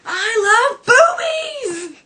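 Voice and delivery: very high, loud, drawn-out yell; hammed-up ecstatic scream; manic shout. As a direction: blurt it out wildly. Smithers after straightening injection.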